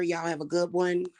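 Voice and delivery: ordinary talk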